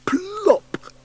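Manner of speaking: loud plop. A narrator's reading. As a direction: burst out the plop. Anansi jumps down.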